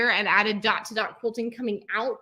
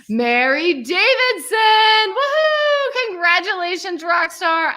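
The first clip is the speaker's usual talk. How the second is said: name sung out slowly, woohoo much higher and louder; sing-song cheer; 8 semitones higher, 8 dB louder, slower than usual. Real, not acted. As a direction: sing her name like a celebration. Announcing graduate name.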